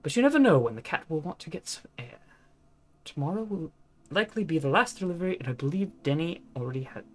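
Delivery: put-on old posh British accent. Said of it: "old posh in British accent".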